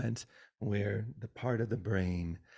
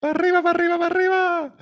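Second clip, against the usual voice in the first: much higher, louder squealing [name] cry; [name] impression; 11 dB louder, much faster than usual. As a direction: shriek it like [name] on stage. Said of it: "like, Dracula and [name]".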